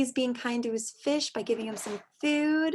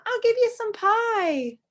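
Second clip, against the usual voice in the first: higher, sweet voice for the kind lady; kind lady's voice; 11 semitones higher, 5 dB louder than usual. Acted. Teacher voicing a kind lady offering pie, higher.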